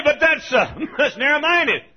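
high, loud mock protest; objector's voice. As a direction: mimic an indignant critic. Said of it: "Well, you say".

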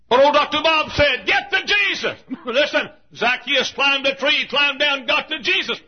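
high, shouted altar call; full-force preaching. Real, not acted. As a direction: cry out the invitation to the crowd.

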